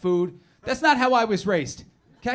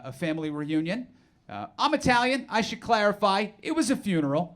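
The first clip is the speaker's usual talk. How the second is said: ordinary delivery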